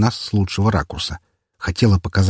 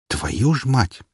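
plaintive groan; 3 semitones higher, slower than usual. Acted. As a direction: groan it out in dismay. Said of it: "Не получилось сдержать жалобный стон".

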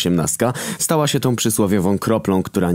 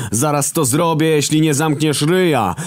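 sudden yell; 10 semitones higher than usual. A narrator's reading. Hunger yelling at Death from the bed. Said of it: "wrzasnął nagle Głód".

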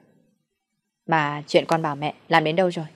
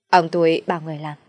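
usual speaking voice

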